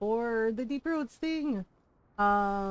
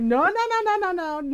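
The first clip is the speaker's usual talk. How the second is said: protesting no's; 8 semitones higher, 7 dB louder than usual. Retold or imitated. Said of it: "And Ron's like".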